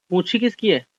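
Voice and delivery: usual speaking voice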